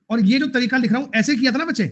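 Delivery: ordinary talk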